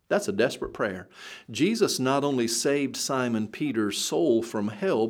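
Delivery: ordinary delivery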